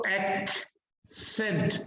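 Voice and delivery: "एक्सेंट" broken slowly into ek, pause, sent; split syllables. Shown as a demonstration. Teacher explains the two Cs, split syllables. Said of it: "इसमें ए के बाद सी आया".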